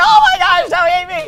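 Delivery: high, loud frightened plea; panicked cry. Acted. Cub pleading with the lion not to eat him, panicked.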